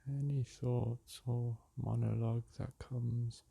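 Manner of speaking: calm, unhurried meditation voice with long pauses; soothing meditation guide, leaving space between phrases; guided meditation. Acted. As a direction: guide them gently back to the breath.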